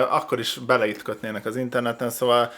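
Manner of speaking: ordinary delivery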